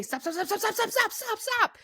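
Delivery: rising frantic stop stop; panicked yelling. Retold or imitated. Friend panicking at gate.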